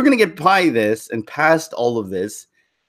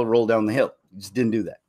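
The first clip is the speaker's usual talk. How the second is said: normal talking voice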